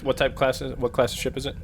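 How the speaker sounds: usual speaking voice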